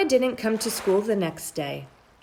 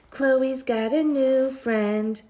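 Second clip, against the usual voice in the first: taunting sing-song; 6 semitones higher, slower than usual. A narrator's reading. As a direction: rub it in like a playground taunt. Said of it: "Andrew whispered".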